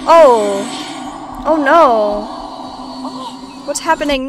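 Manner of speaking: loud, dismayed exclamations; alarmed. Real, not acted. Player watches the superpowers fail, alarmed. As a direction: cry out in sudden dismay.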